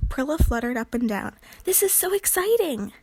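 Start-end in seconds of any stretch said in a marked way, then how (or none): 1.6-2.9 high, bright-eyed burst of glee; excited. Acted. Prilla fluttering up and down, gushing excitedly. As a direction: bubble over with excitement.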